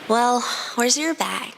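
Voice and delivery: put-on slow, weary girl's question; exasperated girl. A narrator's reading. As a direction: ask with tired patience. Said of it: "she asked".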